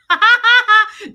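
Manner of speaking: high, loud burst of real laughter. Real, not acted. Telling viewers her habit, bursting into laughter. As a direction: cracks up mid-story.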